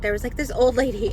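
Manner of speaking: normal talking voice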